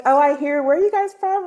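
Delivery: high-pitched, friendly Southern-accent imitation; imitated Southern accent. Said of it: "guy who had a Southern accent".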